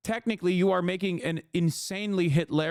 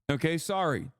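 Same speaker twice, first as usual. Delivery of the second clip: ordinary talk, nothing marked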